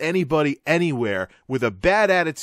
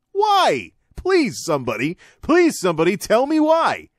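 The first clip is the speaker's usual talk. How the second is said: exasperated plea; 8 semitones higher, 3 dB louder than usual. Real, not acted. Ranting to viewers about broken hiring, exasperated high plea. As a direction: pitch jumps way up, begging for an answer.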